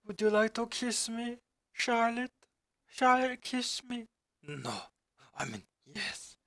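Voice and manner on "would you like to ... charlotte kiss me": high, pleading mock-romantic voice; romantic lover. [name] pleading with Charlotte for a kiss, hammed-up. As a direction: croon it like a hammy lover. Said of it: "Oh, [name]".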